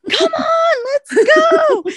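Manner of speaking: high, loud battle charge yell; gung-ho rallying cry. Retold or imitated. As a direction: imitate a friend rallying troops, balls to the walls. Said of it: "balls to the walls, gung ho".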